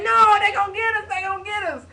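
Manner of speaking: panicked. Retold or imitated. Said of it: "my brother was screaming".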